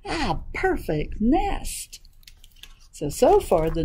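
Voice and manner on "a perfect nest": high, very drawn-out goose exclamation; goose's cry. Acted. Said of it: "she cried".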